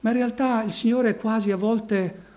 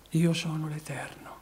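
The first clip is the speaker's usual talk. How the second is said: hushed divine voice; 2 semitones lower, 7 dB quieter than usual. Acted. God's words voiced softly.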